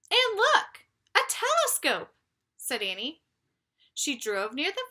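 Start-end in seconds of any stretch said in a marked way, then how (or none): 0.1-2.1 much higher and louder, eager; high, loud childlike discovery; excited. Acted. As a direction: point it out with a thrill. Annie spots telescope.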